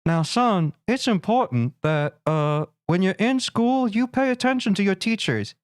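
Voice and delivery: slow, lightly voiced fatherly lecture; lecturing dad. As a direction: take it slow like a dad giving a life lesson. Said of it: "Giving your son life lessons".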